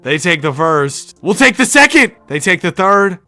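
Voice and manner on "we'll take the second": excited shout. Real, not acted. Winning a finals game.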